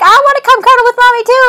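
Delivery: high, sweet puppy voice; high cheerful dog voice full of love; happy puppy voice. Retold or imitated. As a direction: voice the goofy friendly puppy, up high. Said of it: "Dewey's like".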